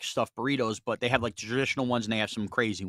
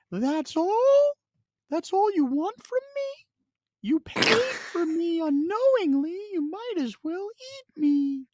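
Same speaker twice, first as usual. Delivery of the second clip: very high, offended burrito; a snubbed burrito complaining it was barely touched; talking burrito voice; 4 dB louder, slower than usual. Acted. Burrito hears Sean upstairs after one sliver, very high offended voice. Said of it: "I heard the burrito from upstairs going".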